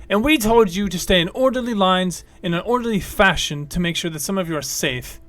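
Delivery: loud, exasperated lecturing; dwarf yelling back at the crowd; angry yell. Acted. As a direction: shout the rules back at them.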